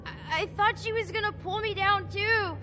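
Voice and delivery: put-on sobbing child; crying. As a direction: choke it out through tears. Child sobs recalling body.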